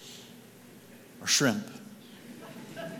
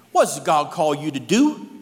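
put-on Cajun accent; 2 semitones higher, 7 dB louder than usual. Cajun stranger asks the janitor his calling, a touch of Cajun. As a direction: ask it like a Louisiana Cajun stranger. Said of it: "Cajun guy came in".

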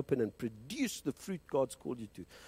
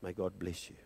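ordinary delivery

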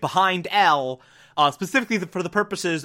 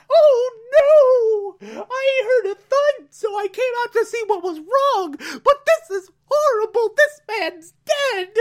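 high falsetto mock panic, louder in bursts; hammed-up horrified thief; 5 dB louder than usual. The thief pretends to find a dead man, high hammed-up panic. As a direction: overact finding the body, squealing high. Thief fakes finding a body.

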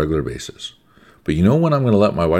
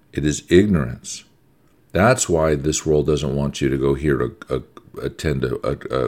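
ordinary delivery

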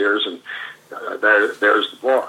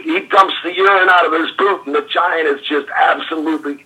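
ordinary talk, nothing marked

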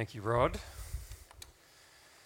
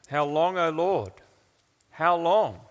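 raised cry of lament; emotional plea; 3 semitones higher, 4 dB louder than usual. Acted. Preacher voicing those who pray these words, emotional plea. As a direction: plead it, building on the repeat. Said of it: "normally are dripping with emotion".

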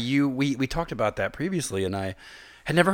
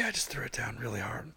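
whispering; 12 dB quieter than usual. Real, not acted. Spoke unvoiced after drinking.

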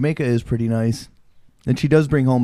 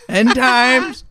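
high, loud, mocking prophecy; mocking mom; 11 semitones higher, 5 dB louder than usual. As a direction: ham up mom's doom warning. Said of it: "That's what she would say".

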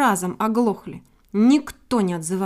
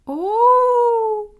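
loud booming call from below; booming hoot; 11 semitones higher, 7 dB louder than usual. A narrator's reading. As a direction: boom it up out of a pit. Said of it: "загудела дыра".

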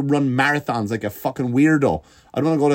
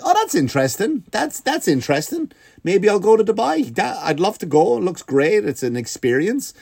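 high, sing-song fake enthusiasm; mock interested; 8 semitones higher than usual. Comic telling his own tempted reply about Dubai, high and eager. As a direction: sound naively tempted by Dubai. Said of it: "I was like".

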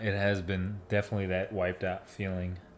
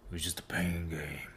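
whispered; 4 semitones lower, 8 dB quieter than usual. Real, not acted. Aside, unvoiced.

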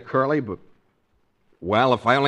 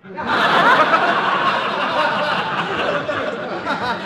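high, drawn-out burst of laughter; 11 semitones higher than usual. Real, not acted. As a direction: laugh it off, pitched up. [name] laughs at Curly's singing.

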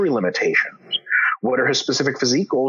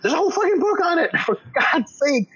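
much higher, heated exasperation; exasperated rant; 10 semitones higher than usual. Real, not acted. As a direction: vent at the clueless commenters. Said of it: "exasperated rant at commenters".

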